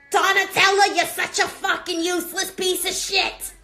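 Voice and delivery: high, loud imitation of a husband's tirade; mock marital bellowing; yelling husband. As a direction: holler it like an angry spouse. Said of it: "my husband yelling in my ear".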